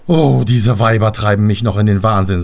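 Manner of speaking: loud, weary groan of complaint; exasperated father. Acted. Vater Rhein complains about his daughters, loudly exasperated. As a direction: grumble it loudly on one level.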